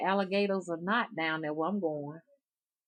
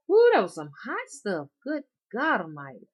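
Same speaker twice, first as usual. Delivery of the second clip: surprised; 4 semitones higher, 7 dB louder than usual. Retold or imitated. Burn of strong liquor.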